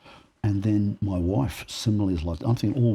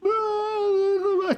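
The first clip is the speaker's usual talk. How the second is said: laughing. Real, not acted. Laughing while telling the story.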